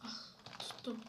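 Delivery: ordinary delivery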